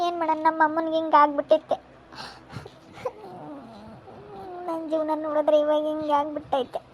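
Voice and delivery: tearful lament with long halts; crying. Real, not acted. Confiding in an elder about her ruined life, crying. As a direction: let the tears break between phrases. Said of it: "ಅಳ್ಬೇಡ ಸುಮ್ನಿರು".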